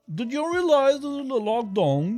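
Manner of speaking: high, drawn-out mock British accent. Streamer mimicking a clip he likes, exaggerated British accent. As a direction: imitate a British accent, slow and high-pitched. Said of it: "J'aime ça, l'accent britannique".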